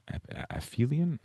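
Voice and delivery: false start "Ap-", then "aphelion" as a soft, high uh-FEE-lee-un; hesitant pronunciation attempt. Real, not acted. Teacher second-guessing a vocab term, hesitant pronunciation. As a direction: try "aphelion" with an f sound, quietly and doubtfully. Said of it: "let me see how to pronounce that".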